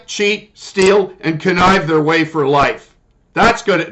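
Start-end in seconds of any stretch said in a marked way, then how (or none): none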